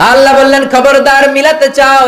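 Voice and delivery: high, loud sermon warning; fiery preaching cry; thundering preacher. Acted. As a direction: boom the warning out. Preaching at full force.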